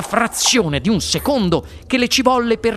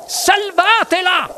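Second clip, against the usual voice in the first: high, drawn-out cry for help; 14 semitones higher, 4 dB louder, slower than usual. A narrator's reading. Mrs Salt begs them to save her daughter, crying out. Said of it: "gridò la signora Salt".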